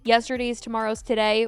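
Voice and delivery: ordinary talk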